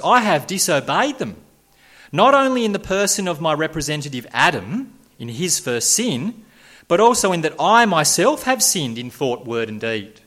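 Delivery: a hint of a sinner confessing; lightly voiced confessing man. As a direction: confess plainly to the law. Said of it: "says the man saved by grace".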